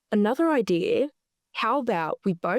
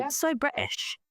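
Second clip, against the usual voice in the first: higher-pitched put-on British accent; teasing British imitation; mock British accent; 7 semitones higher, 4 dB quieter than usual. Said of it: "those are British accents as well".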